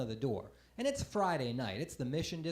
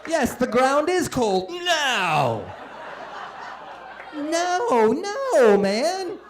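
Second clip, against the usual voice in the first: mock indignant shout; 7 semitones higher, 8 dB louder, much slower than usual. Mock reply to a heckle.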